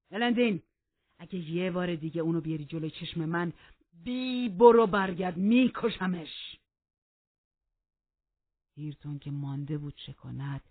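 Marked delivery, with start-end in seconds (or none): 0.1-6.6 loud threat slowing on the last words; furious threat. Acted. Heathcliff threatens to kill her.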